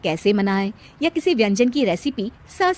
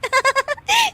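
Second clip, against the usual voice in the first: high cheeky giggle. Acted. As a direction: laugh back at her. Bride laughs. (ऊँची शरारती हँसी)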